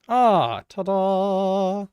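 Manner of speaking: loud, high, drawn-out ta-da; triumphant reveal. Real, not acted. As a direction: present it with a ringing flourish. Showing off the revealed surfaces.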